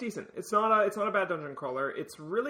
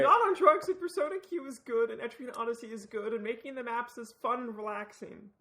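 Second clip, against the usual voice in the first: high insistent protest; 6 semitones higher than usual. Real, not acted. Insisting loudly after accusation.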